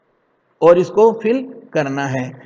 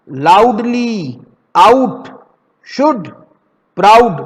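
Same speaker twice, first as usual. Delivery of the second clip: loud word model; 5 dB louder, slower than usual. Shown as a demonstration. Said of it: "बोल के देखोगे ना".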